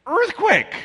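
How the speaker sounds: very high, loud urgent yell; yelled warning. Retold or imitated. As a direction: shout it out, now insistent. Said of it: "She yells again".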